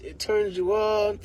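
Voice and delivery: ordinary delivery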